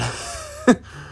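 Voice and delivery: high breathless laugh. Real, not acted. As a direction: burst out laughing. Laughs after near miss.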